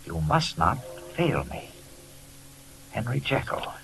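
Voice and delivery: letter read aloud. Acted. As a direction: read it like a desperate plea from a friend.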